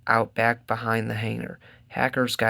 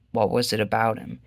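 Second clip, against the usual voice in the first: usual speaking voice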